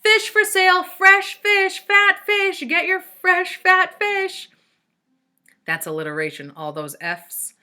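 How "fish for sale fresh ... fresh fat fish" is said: high, loud market-seller chant, slowing at the end; hawker's cry. A narrator's reading. Allison screaming nonsense so someone notices her, loud hawker cry. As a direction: bellow it like selling fish at a market. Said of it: "screaming anything that came to her head".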